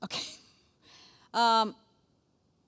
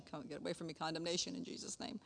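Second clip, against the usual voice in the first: quiet rebuke; 5 semitones lower, 13 dB quieter than usual. Real, not acted. Rebuking condemnation in prayer.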